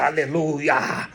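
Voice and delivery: slow, drawn-out "aleluia", a touch mocking; mock hallelujah. Preacher mocking the careless shooter's joy, slow drawn-out aleluia. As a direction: stretch it out like a smug believer.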